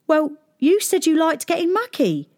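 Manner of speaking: put-on cheeky boy. A narrator's reading.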